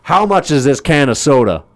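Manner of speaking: high, loud pointed question; mock menace. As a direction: ask like a threat. Threatening question.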